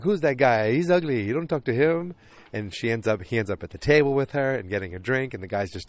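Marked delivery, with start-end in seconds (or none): 0.0-2.1 high, dismissive put-on Italian accent; mock Italian accent. Italian guy warning her off the stranger, high mock Italian accent. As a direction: run down a rival in hammed-up Italian English. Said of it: "the Italian guy's like".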